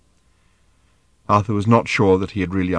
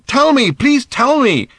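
high, loud, slow plea; urgent pleading; 6 dB louder, slower than usual. A narrator's reading. Father begs his daughter to explain, pleading urgently. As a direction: beg loudly, stretching the words.

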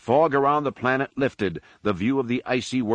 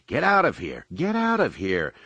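raised-pitch cry of alarm; urgent cry; 4 semitones higher than usual. A narrator's reading. Han warning the whole fleet to flee, urgent cry. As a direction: cry it out as an order to everyone. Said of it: "Han cried through all channels".

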